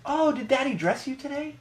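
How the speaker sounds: high, syrupy mock old lady; sweet cashier voice. Imitating the cashier cooing at the daughter, high and sugary. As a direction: coo at the toddler like a doting grandma. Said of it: "and she says".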